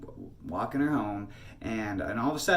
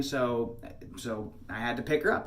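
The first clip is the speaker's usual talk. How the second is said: usual speaking voice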